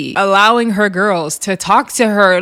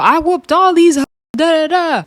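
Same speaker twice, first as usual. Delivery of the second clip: higher-pitched retold yell; mock yelling; 8 semitones higher than usual. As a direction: imitate someone yelling boasts, not in earnest. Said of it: "you over here yelling".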